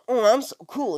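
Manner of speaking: mock full-of-himself Naruto; cocky kid thinking he's cool; smug. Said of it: "Naruto's thinking".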